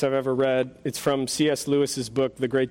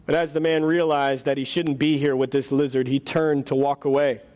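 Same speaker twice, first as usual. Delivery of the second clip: ordinary talk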